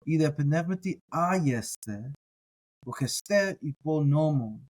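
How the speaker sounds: slow deliberate Greek recitation; careful Greek reading. Shown as a demonstration. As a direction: read the Greek slowly and carefully. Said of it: "you folks heard it".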